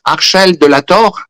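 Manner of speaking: imitating a French seer saying a Spanish name; French accent. Marie-Julie Jané repeating the name to Saint Michael, French accent. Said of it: "con su acento francés".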